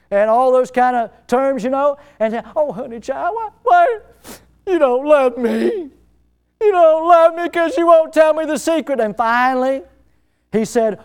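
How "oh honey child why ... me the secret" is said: high-pitched Southern lady, hammed up; Delilah, southern accent. Delilah pleading with Samson for his secret, hammed-up southern accent. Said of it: "how southern ladies can throw out honey and sweetie".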